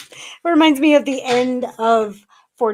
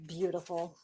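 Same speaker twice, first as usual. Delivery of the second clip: hushed, lower whisper of Beautiful; 8 semitones lower, 14 dB quieter, faster than usual. Real, not acted. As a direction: breathe Beautiful. out in awe. Admiring card softly.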